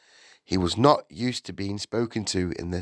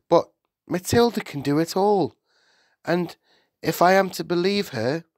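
higher-pitched, earnest schoolteacher; formal teacher's explanation, pitch raised; explaining Miss Honey; 9 semitones higher, 4 dB louder than usual. A narrator's reading. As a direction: lay out the case carefully.

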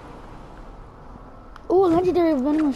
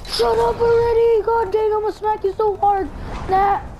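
imitating sister yelling; 8 semitones higher than usual. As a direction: squeal it like an annoyed little sister. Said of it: "she's like".

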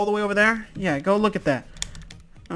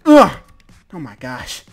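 ordinary talk

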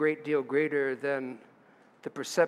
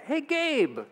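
calling out; 11 semitones higher than usual. Pretend. Mock calling the grown boy.

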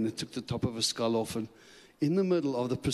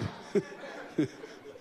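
quiet breathy laugh; 5 dB quieter than usual. Real, not acted. Laughing at himself.

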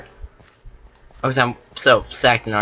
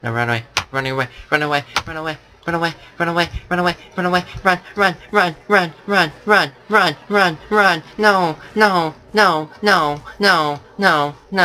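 panicked, rising fast chant; being chased; 5 semitones higher than usual. Real, not acted.

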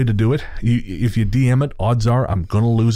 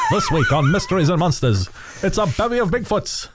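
higher, hammed-up newsreel announcer; old-timey newsman; 8 semitones higher than usual. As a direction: oversell it like a vintage trailer. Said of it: "transatlantic thing".

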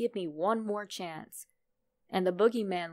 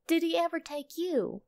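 put-on higher, eager little boy's voice; young son; 10 semitones higher than usual. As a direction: play the curious little son. Son asks the father.

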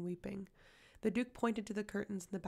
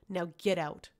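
angry order; 6 dB louder than usual. A narrator's reading. Duke ordering Manon out.

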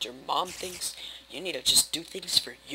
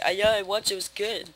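fake casual friend; 4 semitones higher, 4 dB louder than usual. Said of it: "they're gonna lie and say".

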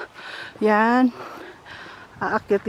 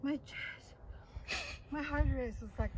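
breathless; 21 dB quieter than usual. Real, not acted. Panting after the hike.

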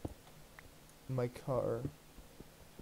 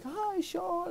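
high, sing-song mock "Hi, Sean"; mock sugary greeting. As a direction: mimic her over-sweet hello way up high. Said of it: "she's like".

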